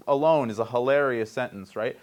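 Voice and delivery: ordinary talk, nothing marked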